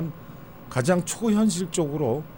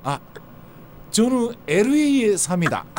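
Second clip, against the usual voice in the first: Japanese accent Korean; 7 semitones higher than usual. Shown as a demonstration. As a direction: greet like a Japanese speaker. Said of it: "뭐 이런 식이에요".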